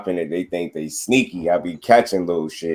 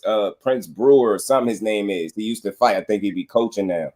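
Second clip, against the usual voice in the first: normal talking voice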